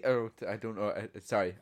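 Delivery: ordinary talk, nothing marked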